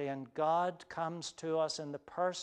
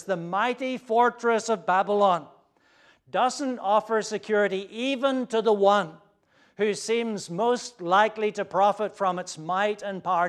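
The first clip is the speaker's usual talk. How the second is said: louder, higher, full-force pulpit voice; forceful preaching; 4 semitones higher, 9 dB louder than usual. Acted.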